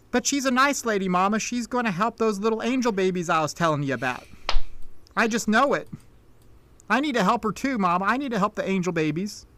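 put-on earnest country child pleading with Mama; pleading little girl. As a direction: plead like a small girl being pulled indoors. Little girl pleads with her mother.

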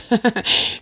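short high laugh at her own joke; a quick real laugh; amused laugh. Real, not acted. Laughing at the neighbor's accusation, real laugh. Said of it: "So I laughed".

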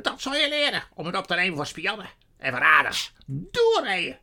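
high, loud scolding into a command; furious sorceress. A narrator's reading. Witch scolds Edmund for defending spies, furious. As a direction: shriek the reprimand and order.

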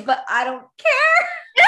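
high and loud, laughing it out. Real, not acted. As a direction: say it through a laugh, slowly. Defends liking the cliché.

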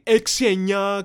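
loud, drawn-out shouted digits; angry shouting customer. Retold or imitated. Customer yelling his phone number at the waiter, angry shout. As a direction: yell it slowly down the phone. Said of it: "Αρχίζει και μου φωνάζει".

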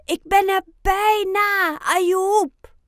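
high, loud, long-carrying call; shouting to friend. A narrator's reading. Oscar reassures his hurt friend from afar, shouting. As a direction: shout it across the distance. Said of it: "schreeuwt Oscar naar zijn vriend".

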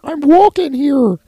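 put-on high, loud caricature with Brooklyn accent; drawn-out Brooklyn street holler, high voice. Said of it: "if he grew up in Brooklyn".

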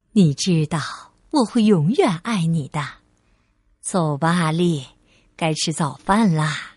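low, tender motherly voice; loving mother, reassuring. Acted. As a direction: reassure your little one sincerely.

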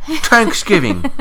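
imitating a Dublin "Thanksgiving"; Irish Thanksgiving. Mimicking the actress's Irish "Thanksgiving" for a co-host. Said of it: "she didn't say Thanksgiving".

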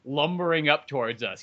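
ordinary talk

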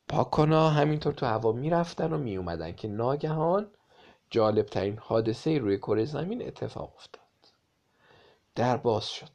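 ordinary delivery

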